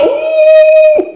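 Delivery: drawn-out, very high "ooh" howl; long comic sob-wail; mock crying wail. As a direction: imitate a man bawling, hammed up. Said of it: "Dia lagi teriak".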